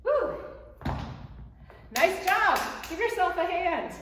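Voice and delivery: loud, high celebratory cheer; excited cheering. Real, not acted. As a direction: celebrate like a proud coach. Celebrating the finished workout.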